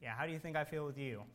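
softly imitated voice of conscience; still small voice. God answering an angry dog owner, small and soft. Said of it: "I had a still small voice".

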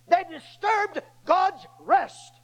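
"They disturbed God's rest" drawn out slowly, each word weighted; slow emphatic. Acted. Preacher driving home his point, slow and weighted. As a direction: lean on every word, slowly.

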